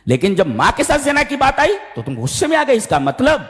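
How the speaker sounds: high-pitched, impassioned reproach; scholar's heated rebuke. Retold or imitated. Scholar challenges students who stayed silent about shirk, heated rebuke. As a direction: scold them as the exasperated teacher. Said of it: "حضرت نے فرمایا کہ تم نے".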